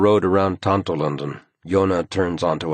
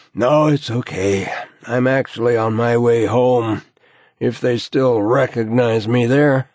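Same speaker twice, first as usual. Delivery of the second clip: higher, louder, worn-out colleague; weary, wry man on his way home; tired investigator voice; 6 semitones higher, 5 dB louder than usual. A narrator's reading. Narrator voicing tired Eriksson.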